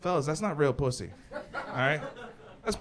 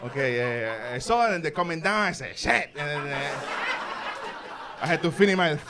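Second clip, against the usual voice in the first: put-on Mexican accent; 2 semitones higher than usual. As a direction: tell the alien fight in broken accented English. Hero retells alien fight accented.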